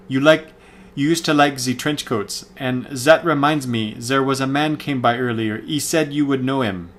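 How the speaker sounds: clumsy mock French accent on the villain's lines; bad French accent. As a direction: ham up a comic-book French accent, badly. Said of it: "with a really bad French accent".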